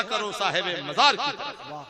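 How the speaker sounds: loud, high shouted climax; shouted preaching. Acted. Preacher proclaiming the two qiblas in a loud shout. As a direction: hammer the point home.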